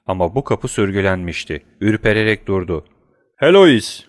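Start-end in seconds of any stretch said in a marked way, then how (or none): 3.4-4.0 loud high call of his wife's name; shout. A narrator's reading. Husband shouting for his wife through a bolted door, loud. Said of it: "diye bağırdı".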